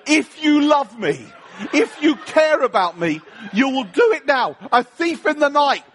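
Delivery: hammed-up frantic prayer, climbing in pitch; desperate plea. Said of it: "it's so desperate that it's like nothing else".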